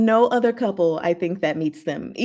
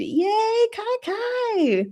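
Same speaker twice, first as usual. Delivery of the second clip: much higher, bright "yay"; gleeful shout-out, pitched way up; excited cheer; much slower than usual. Real, not acted. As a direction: cheer the viewer on. Cheering a viewer's pick.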